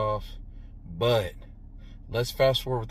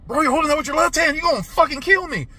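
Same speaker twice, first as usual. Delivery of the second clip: much higher, louder, fast frightened plea; hammed-up terrified plea to his girlfriend; mock panic; 13 semitones higher, 5 dB louder than usual. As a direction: freak out like she's about to kill you. Panicking about the saw.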